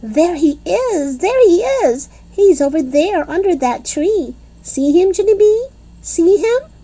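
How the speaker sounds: very excited. A narrator's reading. Grace excitedly pointing the boy out to Junie B, squealing. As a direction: jump up and down pointing him out. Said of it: "very excited".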